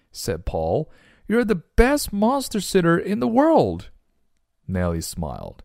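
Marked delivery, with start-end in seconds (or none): 1.3-3.9 very high, delighted praise; storybook dad gushing, pitched way up; impressed father. Acted. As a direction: heap praise in a soaring character voice.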